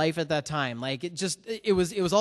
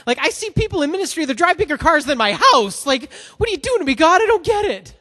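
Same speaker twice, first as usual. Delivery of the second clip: much higher and louder, an anguished plea; crying out to God; 9 semitones higher, 9 dB louder than usual. Retold or imitated. Frustrated man crying out to God in his car, loud and anguished. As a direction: throw the complaint up at the sky, pleading. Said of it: "I started crying out to God".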